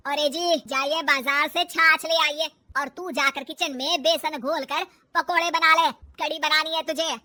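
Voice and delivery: loud, cross mother-in-law; loud nagging commands; bossy scolding. Acted. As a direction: order them about forcefully.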